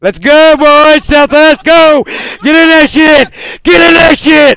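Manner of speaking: loud, rallying battle cry; hype shout. Real, not acted. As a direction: fire the team up, full volume. Shotcaller hyping the charge.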